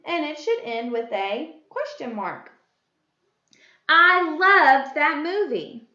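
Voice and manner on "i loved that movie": slow, loud, emphatic enthusiasm; exclamation. Shown as a demonstration. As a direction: exclaim it with drawn-out, strong feeling. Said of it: "An exclamation".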